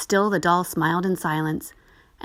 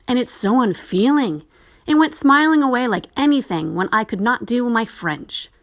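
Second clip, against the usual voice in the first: put-on sulky boy; 5 semitones higher, 5 dB louder than usual. A narrator's reading. Boy complains.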